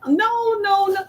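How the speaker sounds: sharp high refusal; high emphatic no. Acted. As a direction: shoot it down, high.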